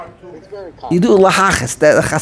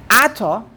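"Atah" set apart, AH-tah, higher start; accent pushed back; 4 semitones higher than usual. Shown as a demonstration. Teacher showing the pushed-back accent, front-stressed "Atah". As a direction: stress the first syllable of "Atah". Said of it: "the accent pushed back to the word Atah".

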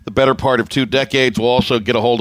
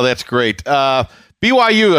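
ordinary talk

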